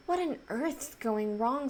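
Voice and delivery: usual speaking voice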